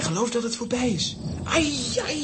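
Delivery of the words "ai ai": high yelp as he falls; high startled ai; cry of pain. Acted. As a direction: yelp in alarm. Suske falls into ravine.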